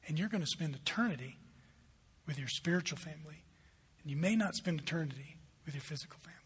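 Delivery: soft, gentle fatherly voice; tender explanation. Acted. Father telling his daughter about her spiritual family, tender and soft. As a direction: break it to the child softly.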